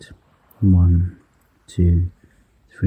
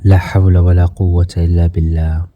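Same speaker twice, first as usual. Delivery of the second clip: quick recitation; much faster than usual. Real, not acted. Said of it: "There is no might and no power except by Allah".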